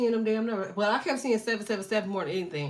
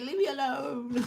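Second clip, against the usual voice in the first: slow, higher mock whine; 4 semitones higher than usual. Vlogger mocking relatives who brush her off. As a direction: whine it like the ones who won't answer. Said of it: "But they say".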